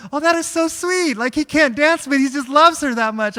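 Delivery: high, loud mock-gushing crowd; gushing onlookers. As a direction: gush like touched guests. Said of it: "everyone's like".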